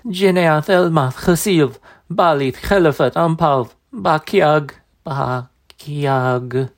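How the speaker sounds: high, slow, wailing crone chant; wailing old woman. Acted. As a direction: wail the foreign words desperately. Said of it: "she wailed".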